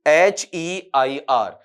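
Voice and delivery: slow letter-by-letter spelling; dictating letters to students; spelled out. Shown as a demonstration. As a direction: read out each letter slowly. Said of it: "अब अगला भी word निकालिए".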